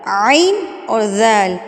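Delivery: عین and ذال spoken slowly and distinctly; name عین اور ذال slowly, letter by letter; slow letter naming. Shown as a demonstration. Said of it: "میں نے دو لفظ یہاں پر مینشن کیے ہیں". (آہستہ حروف کے نام)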